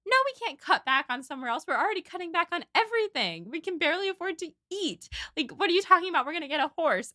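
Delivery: acted exasperation, pitched high; mildly snapping, high-pitched protest; about to snap. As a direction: rattle it off like patience is running out. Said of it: "Eleanor is about to snap".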